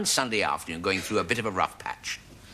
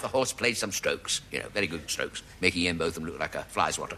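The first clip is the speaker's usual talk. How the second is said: ordinary delivery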